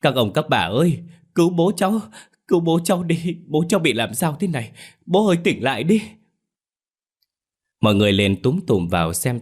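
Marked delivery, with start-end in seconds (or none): 0.0-6.0 high, crying plea for help; sobbing wail. A narrator's reading. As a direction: wail to the neighbours through sobs. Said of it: "khóc nức nở ... mà gào lên".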